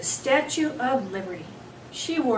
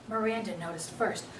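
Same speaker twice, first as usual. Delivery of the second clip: ordinary delivery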